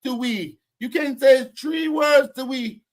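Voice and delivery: put-on loud Jamaican-accent taunt; mock Jamaican accent. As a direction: parody his Jamaican English at full volume. Mocking the caller's grammar and accent.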